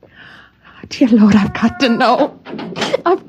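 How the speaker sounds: rising slow, high, pleading; desperate longing. Acted. Wife giving in to temptation at the shutters, desperate and pleading. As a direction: stretch it out, giving in.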